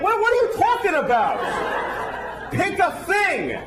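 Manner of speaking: high-pitched, pleading frustration; exasperated outburst. Acted. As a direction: lose patience with a rambling storyteller. Comic exasperated at wife's rambling story.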